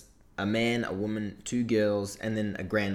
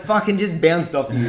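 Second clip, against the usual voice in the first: laughing admiration; 6 semitones higher, 7 dB louder than usual. Retold or imitated. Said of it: "And I was like".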